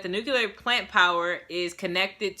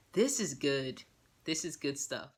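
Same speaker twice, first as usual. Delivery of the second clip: low, hushed satisfaction; sit back and savour it under your breath; quietly savouring; 7 semitones lower, 8 dB quieter than usual. Retold or imitated. Viewer enjoying the soap drama, quietly savouring.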